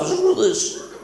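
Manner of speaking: high, urgent radio host call; Alan Jones impression. Shock jock urging listeners, high excited call. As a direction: squeal it out like a worked-up talkback host. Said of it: "Mister Alan Jones".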